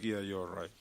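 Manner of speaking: low, soft, calm back-and-forth; soft couple dialogue. Retold or imitated. Couple talking softly after a quarrel. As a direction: speak gently like making up. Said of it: "she's like".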